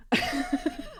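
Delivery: high burst of giggling; giggle. Real, not acted. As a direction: laugh it off, high and light. Joking about finding God.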